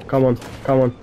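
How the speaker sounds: usual speaking voice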